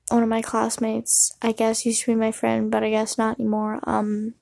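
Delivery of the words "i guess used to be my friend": normal talking voice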